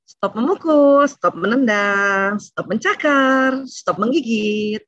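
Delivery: put-on slow, drawn-out commands to a child; measured, insistent parental warning; firm stop commands. Shown as a demonstration. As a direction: stretch each order out firmly.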